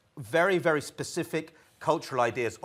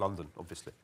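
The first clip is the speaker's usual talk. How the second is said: mock offhand answer; 7 semitones lower, 14 dB quieter, faster than usual. Russians asked about kids' schooling.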